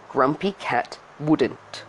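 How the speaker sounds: usual speaking voice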